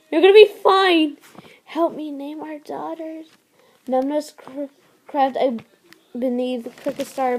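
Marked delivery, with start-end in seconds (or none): 0.1-1.2 higher, louder forced hope; mate insisting in panic; loud desperate reassurance. Acted. Mate denies her dying. 1.6-3.3 softer, higher gentle reassurance; a frail loving goodbye; soft tender farewell. Acted. Dying mate's request.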